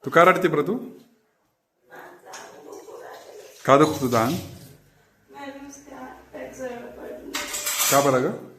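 put-on high, coaxing baby talk to a cat; pet talk. Owner coaxing the cat Mau, high baby talk. Asking the cat why it cries.

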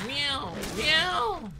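two drawn-out pretend meows; cat meowing. Player meowing along during the game, drawn-out cat cries. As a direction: meow twice like a cat, falling at the end.

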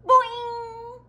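high, loud, drawn-out boing; springy boing held on one high note; cartoon boing. A narrator's reading. Narrator sounds the egg's bounce, loud high boing.

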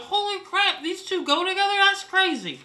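high, loud, amazed outburst. Retold or imitated. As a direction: shout it like you just discovered the combo. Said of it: "I raised my voice 'cause I'm so excited".

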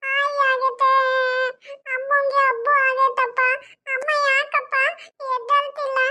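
put-on high, slow, whimpering child voice; a hurt small kid crying for his mother; crying little boy. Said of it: "ಅಳ್ಬಾರ್ದು Chotu". (ಅಳುತ್ತಿರುವ ಪುಟ್ಟ ಹುಡುಗನ ದನಿ)

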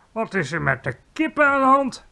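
high, loud demand rising at the end; indignant question. A narrator's reading. Queen told there are no eggs, asking indignantly.

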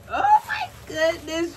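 high, drawn-out oh my goodness; excited gasp. Real, not acted. Showing viewers the doll box, thrilled. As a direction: squeal it in delighted disbelief.

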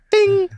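high-pitched imitated lift bell; high "ding". Man imitates his gold lift arriving, high ding. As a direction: ring out like an elevator chime.

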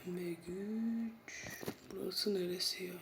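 soft unvoiced search; whispering. Real, not acted. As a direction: whisper while hunting for it. (fısıltıyla arama)

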